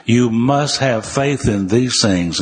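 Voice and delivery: "must" leaned on hard, the whole line slow; stress on must. Retold or imitated. Preacher telling Joe his condition, leaning hard on "must". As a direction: hammer "must" like a stern preacher laying down the rule. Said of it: "boy, did he emphasize that word must".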